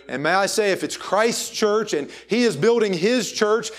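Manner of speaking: usual speaking voice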